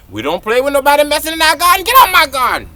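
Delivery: telling slugs to leave, mock angry; mock stern warning. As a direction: shoo the slugs off, hammed-up.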